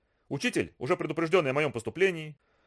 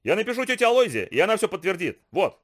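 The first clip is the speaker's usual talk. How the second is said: put-on high indignant boy; 6 semitones higher, 4 dB louder than usual. Bully insists.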